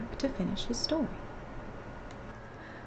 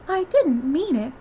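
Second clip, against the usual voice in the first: high, drawn-out plea; apologetic storybook Alice; pleading; 7 semitones higher, 5 dB louder, slower than usual. A narrator's reading. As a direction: plead slowly in a raised voice. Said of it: "pleaded poor Alice".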